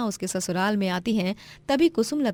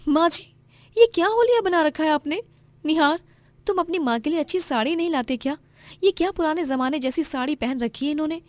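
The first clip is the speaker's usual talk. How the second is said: snobbish daughter-in-law; 7 semitones higher than usual. Acted. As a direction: look down on her mother-in-law. Narrator voices snobbish Roma. (सास का मज़ाक उड़ाती घमंडी बहू)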